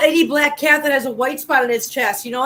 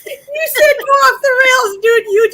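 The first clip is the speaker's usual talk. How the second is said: laughing; 10 semitones higher, 6 dB louder than usual. Real, not acted. Laughing back at co-host.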